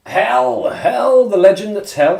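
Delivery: loud, high-pitched shout-out; excited hailing. Real, not acted. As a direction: hail an arriving regular loudly, pitched right up. Hailing a viewer who joins.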